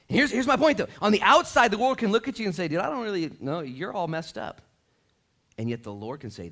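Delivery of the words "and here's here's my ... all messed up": high, loud, driving preacher voice; full-force preaching. Real, not acted. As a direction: pile up the list with rising force.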